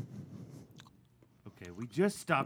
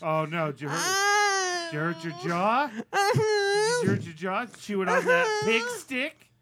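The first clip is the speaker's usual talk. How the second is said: high, loud mock sobbing; over-the-top crying worry about his jaw; hammed-up wailing; 13 semitones higher, 7 dB louder than usual. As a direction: wail it in fake hysterics. Hysterical mock crying concern.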